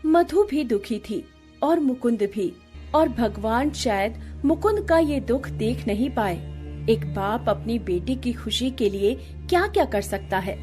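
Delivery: ordinary delivery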